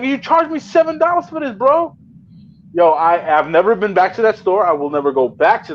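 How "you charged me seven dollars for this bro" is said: acted protest, pitched way up; indignant disbelief. Customer challenging the seven-dollar charge, indignant disbelief. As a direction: re-enact an incredulous, high-voiced objection. Retold protest over the price.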